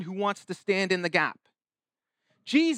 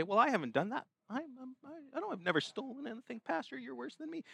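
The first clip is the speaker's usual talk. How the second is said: mock self-righteous protest, much softer; 5 semitones higher, 10 dB quieter, faster than usual. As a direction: protest under your breath, higher and hurried. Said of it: "have a little self-righteous attitude".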